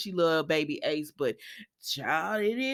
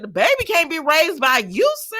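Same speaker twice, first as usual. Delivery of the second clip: sassy retort; 7 semitones higher, 9 dB louder than usual. Retold or imitated. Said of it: "I said".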